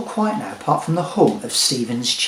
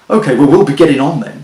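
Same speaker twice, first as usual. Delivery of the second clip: hurried brush-off; 11 dB louder, faster than usual. Acted. Jeremy hurrying away.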